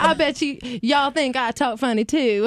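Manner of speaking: playful imitation of a Southern cowboy; Southern cowboy accent. Cowboy teasing English musicians on a plane, imitated Southern accent. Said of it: "He's like".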